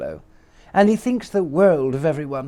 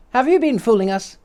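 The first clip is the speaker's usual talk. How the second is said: put-on accusing boy voice; 9 semitones higher than usual. Boy confronts little man.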